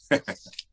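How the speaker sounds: short, low-key chuckle; laugh. Acted. Gangster amused the judge is on the spot, chuckling. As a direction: chuckle briefly after your remark. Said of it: "it's nothing to laugh about".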